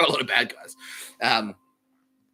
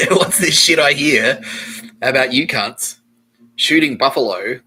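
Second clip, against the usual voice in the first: angry; 2 semitones higher, 10 dB louder than usual. Acted. Angry warrior confronting buffalo hunters, loud and furious. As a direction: hammer out the accusation as the angry bad guy. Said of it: "'cause he's fucking angry".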